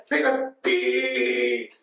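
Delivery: squeaky creature cry, last syllable drawn out; Pikachu. Retold or imitated. Pikachu being captured, drawn-out squeal. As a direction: stretch out a little cartoon creature squeal. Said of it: "And then Pikachu's like".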